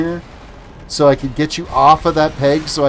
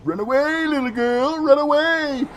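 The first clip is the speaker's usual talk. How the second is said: mad scientist; 11 semitones higher, 5 dB quieter than usual. Pretend. Taunting while fleeing in game.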